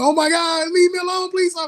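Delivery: very high mock-panicked plea; demonstrated screaming. Shown as a demonstration. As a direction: play the screaming victim, pitch way up. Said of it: "just start screaming".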